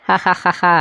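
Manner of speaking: soft mocking ha-ha; sneering laugh at the tiny rival. Kannaiya sneers at the brother, soft mocking laugh.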